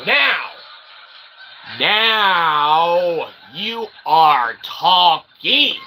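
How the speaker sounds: usual speaking voice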